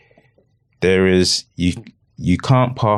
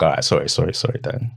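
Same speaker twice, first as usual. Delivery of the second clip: softer, lower mumbled sorry; sheepish apology; 7 semitones lower, 5 dB quieter than usual. Retold or imitated. Son apologising to his dad after a telling-off, soft and low. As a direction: back down and apologise quietly. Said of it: "he's like".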